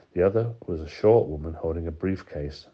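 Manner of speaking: usual speaking voice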